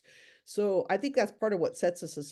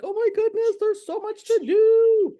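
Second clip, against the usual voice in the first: high, loud frazzled squeal; overwhelmed office worker squealing about her workload; mock panic; 10 dB louder than usual. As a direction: play the overwhelmed worker losing it. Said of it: "you're like".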